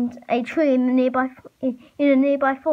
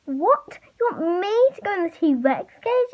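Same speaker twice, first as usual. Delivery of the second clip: high-pitched, incredulous exclamation; exclaiming; 7 semitones higher than usual. A narrator's reading. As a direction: exclaim it in a higher voice. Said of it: "exclaimed Kenji".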